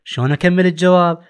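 ordinary talk

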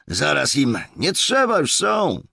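very high, loud called-out reply; shouting back. A narrator's reading. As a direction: shout the answer across the clearing. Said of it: "odkrzyknął posterunkowy". (odkrzykiwanie wysokim, donośnym głosem)

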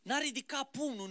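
ordinary talk, nothing marked

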